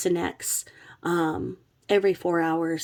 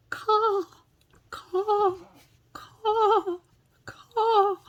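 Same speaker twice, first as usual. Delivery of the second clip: crow caw; much slower than usual. Shown as a demonstration. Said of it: "you're supposed to go".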